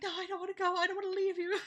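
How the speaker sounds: high, clingy protest; imitating Misa. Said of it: "and he's like".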